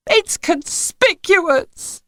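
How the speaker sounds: very slow, high and tearful; weeping. A narrator's reading. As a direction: wail it out, near crying. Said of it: "wept Missus Spender".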